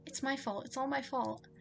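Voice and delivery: high burst, easing into distressed self-blame; frantic, guilt-stricken confession; panicked. Acted. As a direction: blurt it out in rising panic. Panicking as the spell goes wrong.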